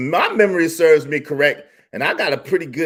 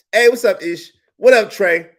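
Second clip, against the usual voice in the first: normal talking voice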